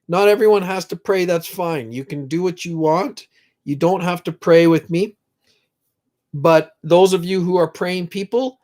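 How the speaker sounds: normal talking voice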